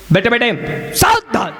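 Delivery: sharp, mock drill-master's call; drill command shout. Drill master calls children to attention, shouted command.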